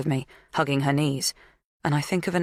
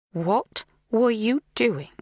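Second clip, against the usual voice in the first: slow, high-pitched questioning; slow, raised-pitch interrogation; menacing questioner; 7 semitones higher, much slower than usual. A narrator's reading. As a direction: draw the question out suspiciously. Magda questions captive Kate.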